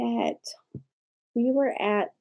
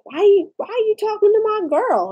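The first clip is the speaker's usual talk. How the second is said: mock defensive; 11 semitones higher, 5 dB louder than usual. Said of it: "got all defensive".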